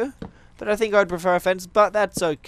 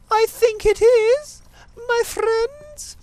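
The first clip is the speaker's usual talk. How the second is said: silly squeaky voice; 3 dB louder, much slower than usual. Pretend. Asking viewers to spam comments.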